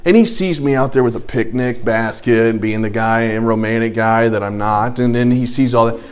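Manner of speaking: normal talking voice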